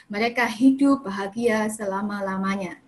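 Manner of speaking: a gentle mocking "happily ever after"; fairy-tale ending. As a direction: read it like the end of a fairy tale. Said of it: "Dongeng kan?".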